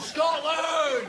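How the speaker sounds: very high, strained patriotic yell; defiant yell. Acted. Trapped man defying the lift, high battle-cry shout.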